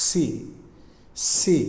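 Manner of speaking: "সি" with air hissed on the s; airy C. Shown as a demonstration. Teacher shows air in the C sound, hissed start. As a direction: let a little air out before the ee. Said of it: "সাউন্ডের একটু হালকা বাতাসও আসতে পারে".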